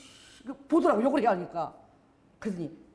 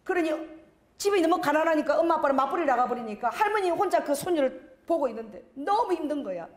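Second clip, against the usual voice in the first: ordinary delivery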